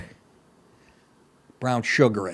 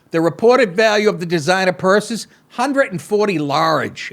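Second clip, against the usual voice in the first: mock anchor reading the story; deadpan read of the news copy; 3 semitones higher, 3 dB louder than usual. Host reading the heist story to listeners, mock news read.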